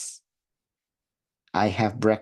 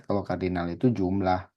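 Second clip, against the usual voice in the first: ordinary talk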